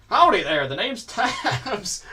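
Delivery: a drawn-out cowboy-ish greeting, mouth wide open; odd put-on accent. Voicing innkeeper Tabs with an odd put-on accent. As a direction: speak slowly with the mouth opened wide, mock drawl. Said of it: "what kind of accent that was".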